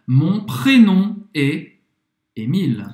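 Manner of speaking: "mon prénom est Émile" spaced out; slow model sentence. Shown as a demonstration. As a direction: say it slowly for learners. (frase-modelo lenta e pausada)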